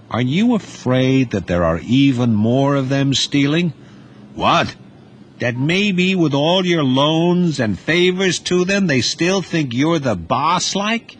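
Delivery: high, insolent youth voice taunting his uncle; a boy poking at his uncle's fears; young Duty, needling. Acted.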